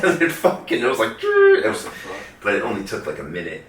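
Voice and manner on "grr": loud, very high-pitched buzzing noise; imitated laser buzz.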